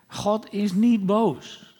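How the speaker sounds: slow, leaning hard on "niet"; reassure, slowly stressing "niet". Real, not acted. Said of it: "emphatic reassurance".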